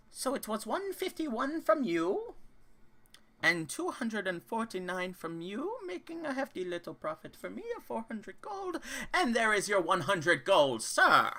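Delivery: high, chirpy gnome collecting coins; a smug little gnome, sweetly polite; gnome merchant voice. Acted. Gnome tallies profit.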